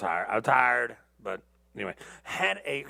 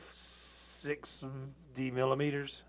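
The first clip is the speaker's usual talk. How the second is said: slow and soft; 6 dB quieter, much slower than usual. Real, not acted.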